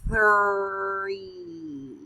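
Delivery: Three said smoothly as one word; blended word. Shown as a demonstration. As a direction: blend the sounds together. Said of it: "Blend them".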